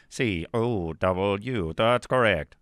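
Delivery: very slow spelled-out letters; Speak and Spell voice. Pretend. Host spelling cow like a Speak and Spell toy, very slow. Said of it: "speak and spell".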